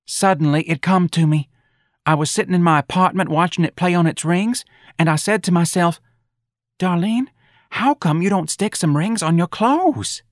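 high, eager character voice; enthusiastic Darlene. Acted. Darlene pitching her bird act to the boss, enthusiastic.

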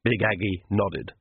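ordinary delivery, nothing marked

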